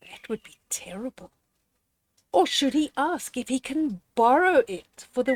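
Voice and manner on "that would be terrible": fast, hushed whisper; a shocked little whisper. Acted. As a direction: whisper it as if it's too awful to say aloud. Reacts to breaking toy.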